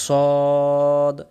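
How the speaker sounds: সদ with the rounded "o" stretched long; drawn out. Shown as a demonstration. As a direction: hold সদ as "sawwwd". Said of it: "টান দিয়ে বলেন". (সদ, অনেকক্ষণ টান দিয়ে)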